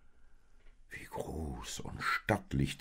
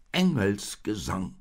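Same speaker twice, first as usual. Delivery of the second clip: slow, loud, firm insistence; higher, emphatic, settling the matter; 5 semitones higher, 6 dB louder than usual. A narrator's reading. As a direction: state it decisively as a stubborn donkey. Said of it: "sagte der Esel bestimmt".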